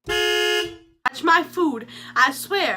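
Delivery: high, very slow menacing warning; mock threat. Bob threatens the narrator over his cheeseburger, mock menace. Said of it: "and he goes".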